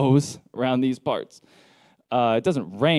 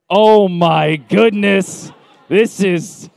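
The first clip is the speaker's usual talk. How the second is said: much louder, slow, stretched-out cry; real loud exclamation at his own rambling; exasperated exclamation; 7 semitones higher, 11 dB louder, much slower than usual. Real, not acted. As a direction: blurt it out loud and drawn out. Laughing at his own tangent.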